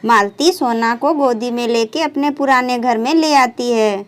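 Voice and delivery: usual speaking voice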